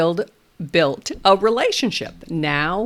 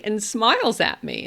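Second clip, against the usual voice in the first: usual speaking voice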